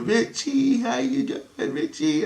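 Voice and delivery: put-on very high, singsong child voice; little girl. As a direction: imitate a small girl calling out a greeting. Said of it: "I can hear the little girl".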